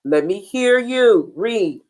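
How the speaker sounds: ordinary talk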